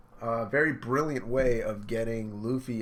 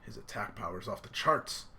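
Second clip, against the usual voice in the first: normal talking voice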